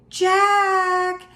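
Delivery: high, drawn-out singsong call; sung-out call across the distance. A narrator's reading. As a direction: call out long and high to children far away. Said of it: "a voice called from the distance".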